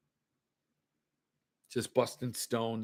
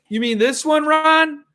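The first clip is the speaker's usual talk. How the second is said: high, loud rising question; loud teasing show-off; 11 semitones higher, 8 dB louder than usual. Real, not acted. Host showing a viewer the scarce book he owns, loud teasing show-off. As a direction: call it out high and loud, rubbing it in.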